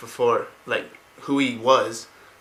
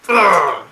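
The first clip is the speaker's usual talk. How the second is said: loud, higher effort grunt; stabbing grunt; 6 semitones higher, 10 dB louder than usual. Retold or imitated. Arthas stabs the kid, loud grunt. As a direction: grunt as if driving in a blade.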